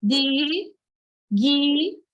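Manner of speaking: "Di, gui" as two drawn-out, separate syllables. Shown as a demonstration. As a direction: pause between "Di" and "gui". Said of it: "pensa que ela se separa assim".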